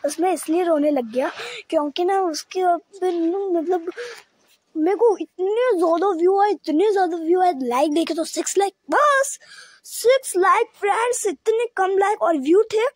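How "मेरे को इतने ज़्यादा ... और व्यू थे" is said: high-pitched disbelief peaking on बस; just six likes, he can't believe it; disbelieving dismay. Real, not acted. (ऊँची आवाज़ में हैरानी)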